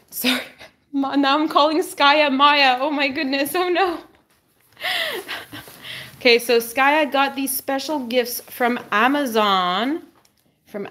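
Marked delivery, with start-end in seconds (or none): 4.8-5.6 a high, quick squeal of a laugh; high squeaky giggle. Real, not acted. Owner laughing at calling Skye Maya, in a high giggle. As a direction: giggle high at your own slip-up. Laughing at her own slip.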